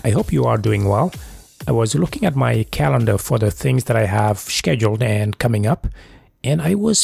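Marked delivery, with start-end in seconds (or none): none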